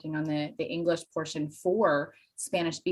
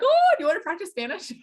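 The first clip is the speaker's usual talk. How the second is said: much higher, louder eager offer; eager reply; 11 semitones higher, 5 dB louder than usual. Retold or imitated. Teacher answering former student: high, louder, eager. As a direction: jump at the chance to practise.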